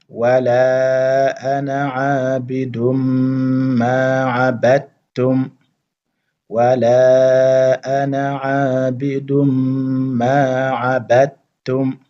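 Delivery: slow, drawn-out chanted recitation; Quranic recitation. Shown as a demonstration. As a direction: recite it slowly, stretching the vowels. Teacher recites verse as model.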